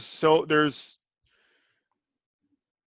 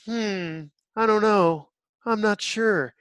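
higher-pitched mock of an emotionless actress; Gal Gadot; 6 semitones higher, slower than usual. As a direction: imitate a blank, unsure movie heroine. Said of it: "She's always just like".

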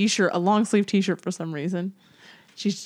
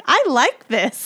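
put-on higher, slow contented kid voice; pleased little girl; 6 semitones higher, 5 dB louder, slower than usual. Mom imitating her daughter liking no underwear, high slow pleased voice. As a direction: savor it like a happy child. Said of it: "later she's like".

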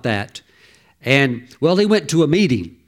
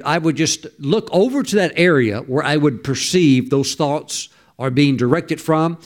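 normal talking voice

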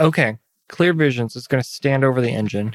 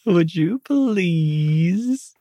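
slow polite request; 3 semitones higher, much slower than usual. Acted. Asking the bird for help.

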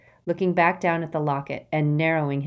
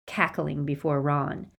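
ordinary delivery, nothing marked

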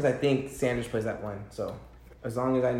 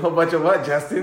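louder teasing demand with a laugh; mock pressing; 4 semitones higher, 6 dB louder than usual. Real, not acted. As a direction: press him on it, almost laughing.

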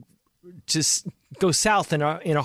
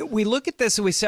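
ordinary delivery

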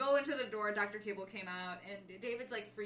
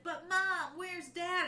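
high, slow, louder plea; mock anxious boy, higher and louder; worried David; 8 semitones higher, 5 dB louder, much slower than usual. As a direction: beg for an answer. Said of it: "he's like".

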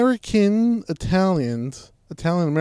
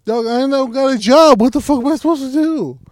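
loud defensive; 11 semitones higher, 10 dB louder than usual. Real, not acted. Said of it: "watching a lot of television".

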